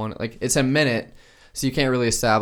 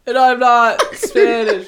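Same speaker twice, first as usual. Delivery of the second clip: loud, high, drawn-out mock reveal; hammed-up big admission; mock serious confession; 12 semitones higher, 11 dB louder, slower than usual. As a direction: wail it in pretend confession, laughing. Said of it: "have a real serious moment".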